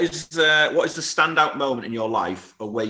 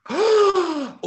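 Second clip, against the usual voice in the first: delighted gasp; 7 dB louder than usual. Real, not acted. Host hears favourite chocolate bar.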